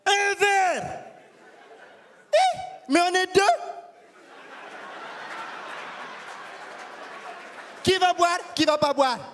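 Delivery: high, mocking voice of a scornful woman; a woman laughing off a cheap drink, high and scornful; mocking African woman. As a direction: scoff at his cheap offer. Woman mocks stingy invitation.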